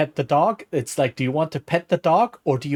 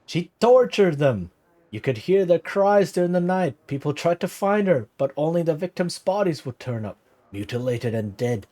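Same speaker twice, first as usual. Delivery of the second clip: lightly voiced old villager, higher pitch, vaguely Irish; put-on Irish-ish accent; 4 semitones higher than usual. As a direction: spin the legend in a wobbly Irish lilt. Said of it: "something weird to Irish".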